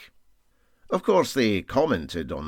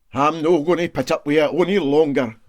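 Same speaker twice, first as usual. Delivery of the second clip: louder, firm Scots protest, every word planted; emphatic; 5 semitones higher, 7 dB louder than usual. A narrator's reading. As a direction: put your foot down, no more of this. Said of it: "said the engineer emphatically".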